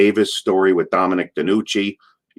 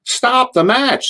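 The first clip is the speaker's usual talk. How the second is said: high, drawn-out mock yell; imitated yelling; 14 semitones higher, much slower than usual. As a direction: imitate a screaming fan, stretched and high. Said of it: "And she's yelling".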